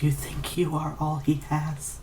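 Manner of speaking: ordinary delivery, nothing marked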